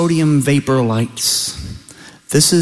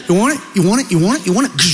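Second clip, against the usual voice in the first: rapid-fire taunt, higher pitch; mock fight challenge; 5 semitones higher, much faster than usual. Deer squaring up to his reflection, higher taunting. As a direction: egg him on with rising repeats.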